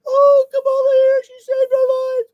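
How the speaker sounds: high, gushing, swooning falsetto; mock celebrity adoration, way up high; mocking Julia Roberts. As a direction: gush like a starstruck fan. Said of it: "Julia Roberts".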